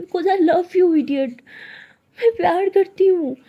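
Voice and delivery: a slow, loud, high-pitched cry of love; loud declaration. Acted. Siya shouts her love at the crying Tara, slow and loud. Answering why she stays.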